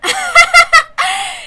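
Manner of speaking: high, loud mocking cackle; evil cackle. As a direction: burst out cackling at them. Villain bursts into laughter.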